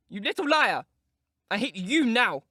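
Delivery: high, loud, breathless rage; panting with passion, hurl it; furious outburst. Acted. Enraged girl panting hatred at her taunting cousin. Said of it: "she panted".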